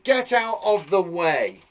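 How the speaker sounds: slow, loud announcement; shouting man. Acted. Man clearing the way, slow mock shout. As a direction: call it out, word by word. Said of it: "The man shouted".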